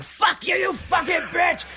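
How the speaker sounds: high, loud mock screaming doll; imitating a killer doll snapping; Chucky's rage. Movie fan voicing Chucky attacking the mother, high furious shout. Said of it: "He's like".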